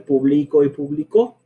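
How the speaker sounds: publico stressed on bli, publicó stressed on the final co, set apart; contrasted stress pair. Shown as a demonstration. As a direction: say both so the stress move is clear. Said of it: "Las tengo aquí puestas".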